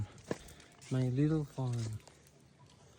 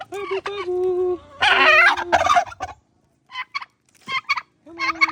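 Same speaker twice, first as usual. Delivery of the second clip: high calling voice; 12 dB louder than usual. Real, not acted. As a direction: coo to the animals up high. Calling to the animals.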